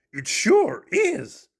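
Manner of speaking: high, very slow monster voice; high puppet voice, content; content Grover. Acted. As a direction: agree happily.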